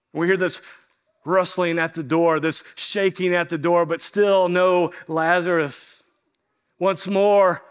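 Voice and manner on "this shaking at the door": ordinary talk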